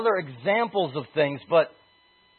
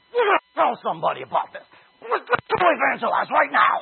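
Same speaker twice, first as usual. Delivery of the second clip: eager believers; 8 semitones higher, 4 dB louder than usual. Retold or imitated. Retelling eager young believers.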